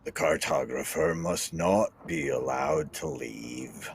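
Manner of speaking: slow, measured in-character plea; deliberate pronouncements with long pauses; solemn, insistent Legion. Acted. Legion demanding the cartographer be left behind, solemn and slow.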